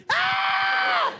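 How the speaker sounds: very high, held "ah"; jumpy shriek at a sudden jolt; startled shriek. Retold or imitated. Jumpy passenger as the plane jolts, high startled shriek. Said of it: "it's gonna come along with this noise".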